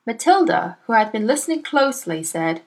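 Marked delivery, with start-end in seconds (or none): none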